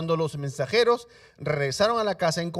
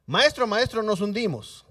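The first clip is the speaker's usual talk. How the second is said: panicked cry; 5 semitones higher, 6 dB louder than usual. Acted. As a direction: call out to the master as the boat sinks. Disciples crying out again.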